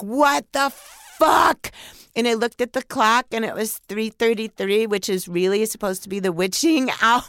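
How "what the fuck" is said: high, louder disbelieving yell; stunned by the portal, a shocked yell; shocked outburst. Retold or imitated. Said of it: "And I was like".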